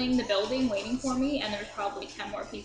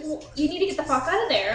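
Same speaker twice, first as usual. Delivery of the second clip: lightly voiced angry mom, fast; mock scolding mom; 5 semitones higher, 5 dB louder, faster than usual. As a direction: mom snapping orders down the phone. Mother orders her out.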